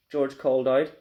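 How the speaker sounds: plain reading voice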